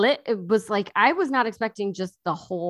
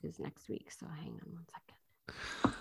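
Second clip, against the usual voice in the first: whisper; 3 semitones lower, 16 dB quieter than usual. Real, not acted. Checking phone, muttering aside.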